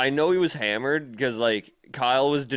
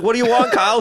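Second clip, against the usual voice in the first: annoyed retort; 10 semitones higher, 6 dB louder, faster than usual. Retold or imitated. Annoyed retort to pestering friend.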